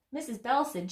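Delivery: plain reading voice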